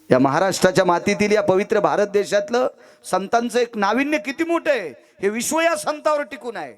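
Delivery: fervent preaching. Acted. Preacher extolling the saints to the crowd, fervent and high. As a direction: preach it out, pitch climbing as you go.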